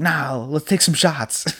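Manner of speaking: lightly put-on Polish accent, unhurried; half-hearted Polish landlord impression, slowed down; mock Polish accent. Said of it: "with his, like, his accent".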